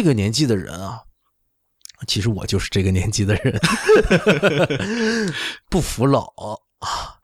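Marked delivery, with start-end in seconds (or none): 3.6-5.7 self-mocking laugh. Real, not acted. Laughs at own age joke.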